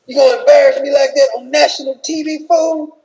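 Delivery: wife berating husband back in the room, mock furious; angry Michelle. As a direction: chew him out like a furious wife. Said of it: "When they get back to the room, like".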